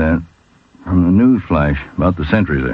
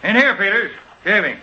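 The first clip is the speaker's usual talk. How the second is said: raised voice answering from afar; calling back; 11 semitones higher than usual. Acted. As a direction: call back from the next room. Answers call from other room.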